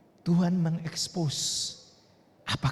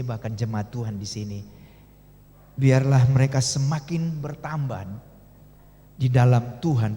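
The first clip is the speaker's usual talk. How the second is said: low, earnest prayer voice; closing prayer; 5 semitones lower than usual. Acted. Pastor praying for the congregation at the close, low and earnest. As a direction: pray over the congregation, low and steady.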